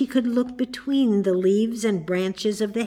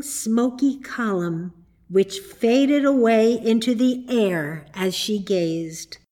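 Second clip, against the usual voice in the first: ordinary delivery, nothing marked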